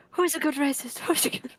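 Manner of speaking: pet talk. Pretend. Baby-talking a game dog.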